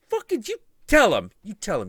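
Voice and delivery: high, loud furious demand; wife demanding Shiva tell the gods; angry demand. Retold or imitated. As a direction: order him to confess. Parvati demanding.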